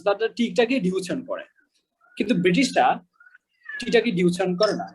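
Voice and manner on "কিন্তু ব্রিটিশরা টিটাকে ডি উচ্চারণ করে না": ordinary talk, nothing marked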